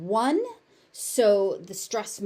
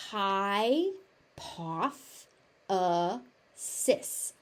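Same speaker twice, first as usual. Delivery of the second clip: "hypothesis" stretched out slowly; slow teaching take of "hypothesis"; much slower than usual. Shown as a demonstration. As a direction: spell "hypothesis" out, syllable by syllable.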